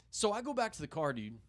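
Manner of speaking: ordinary talk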